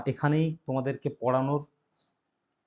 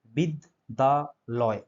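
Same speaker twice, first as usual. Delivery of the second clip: very slow, pausing between each syllable of বিদ্যালয়; broken into syllables; much slower than usual. Shown as a demonstration. Teacher showing students the syllables of বিদ্যালয়, slowly broken up. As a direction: say বিদ্যালয় in three separate beats, drawn out. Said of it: "ভেঙে ভেঙে উচ্চারণ করলে".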